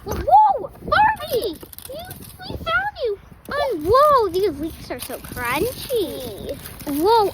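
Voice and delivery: usual speaking voice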